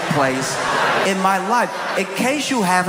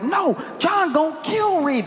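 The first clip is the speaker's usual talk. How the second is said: put-on excited kid voice; 10 semitones higher than usual. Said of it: "They were like".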